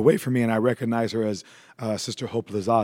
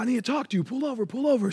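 urgent church lady; 11 semitones higher, faster than usual. Retold or imitated. Voicing the church lady's urgent plea.

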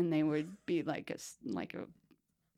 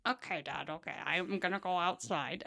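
dog voice; 2 semitones lower, 8 dB quieter than usual. Acted. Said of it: "I have this voice for my dog".

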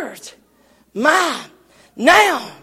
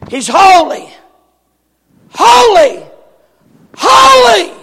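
each holy higher and louder; building each repetition; 5 semitones higher, 4 dB louder than usual. Shown as a demonstration. Showing degrees of repetition, building cry. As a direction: climb with every holy. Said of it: "it would excel with every repetition".

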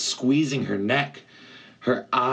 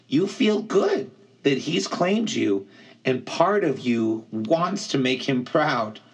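put-on slightly higher young satyr voice; earnest friend, a touch higher; lightly voiced satyr; 5 semitones higher than usual. Grover voiced in audiobook.

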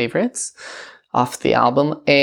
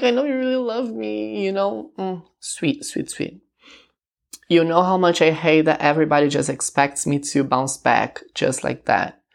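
voicing the singer; 3 semitones higher than usual. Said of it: "she's like".

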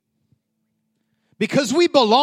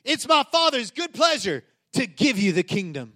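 ordinary talk, nothing marked

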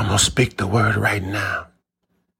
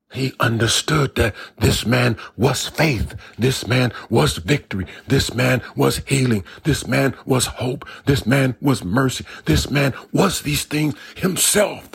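climbing higher with each line of the sermon; rising preaching fervor; 5 semitones higher than usual. Acted. Preacher building to a peak for the congregation, rising fervor.

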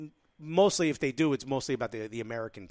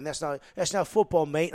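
put-on English soccer-fan accent; lightly mocking, affected English accent; 3 semitones higher, 4 dB louder than usual. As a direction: imitate an American faking an English accent. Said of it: "Where'd you get that accent".

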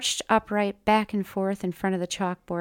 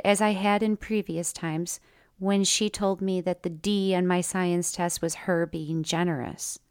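ordinary delivery

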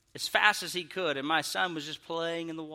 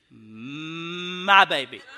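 very slow, swelling to a loud finish; drawn-out indignation; 7 dB louder, much slower than usual. Retold or imitated. Parent protesting to the mother about his pushed child, drawn-out indignation. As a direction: hold the first word long, then swell.

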